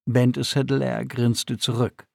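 usual speaking voice

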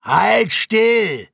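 loud, high-pitched order from a heavy guard; barked command. A narrator's reading. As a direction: bark an order at a prisoner. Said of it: "befahl einer der beiden Überschweren". (lauter, herrischer Befehl)

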